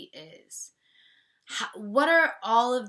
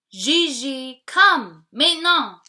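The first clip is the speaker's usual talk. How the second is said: loud, high call across the yard; 7 semitones higher, 15 dB louder than usual. Acted. Mother calling her daughter to come now. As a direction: call the child home, firm.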